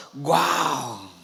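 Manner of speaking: drawn-out wow with falling tune; acted, lingering exclamation of wonder; acted amazement. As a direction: show mild awe. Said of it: "este, emocionado".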